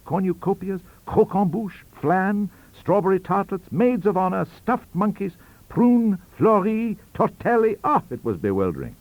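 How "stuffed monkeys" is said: plain reading voice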